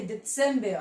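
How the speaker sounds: दिसंबर joined up as "det-SEM-bea"; German pronunciation. Shown as a demonstration. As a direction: put TS inside दिसंबर and drop the R. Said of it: "तो क्या बोलेंगे?".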